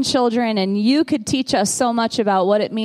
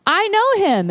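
higher, eager friend voice; lightly voiced friend, pitched up; imitating Melissa; 7 semitones higher than usual. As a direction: lift it up like the excited friend. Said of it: "And she goes".

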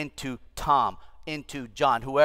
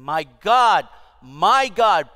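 loud, raised-pitch cry to God; anguished cry; 5 semitones higher, 10 dB louder, much slower than usual. Acted. Preacher voicing Jesus on the cross, crying out loudly.

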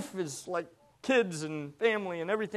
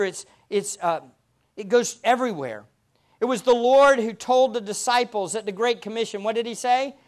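normal talking voice

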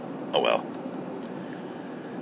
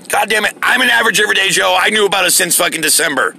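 higher, fast, outraged ranting; furious rant; 6 semitones higher than usual. Real, not acted. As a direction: vent the fury, words spilling out. Furious rant about the president.

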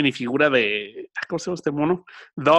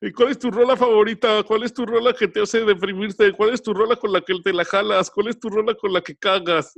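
sing-song mockery of online quiz chains; mocking social media chains; 8 semitones higher than usual. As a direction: parody the silly chain questions. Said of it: "las cadenas de".

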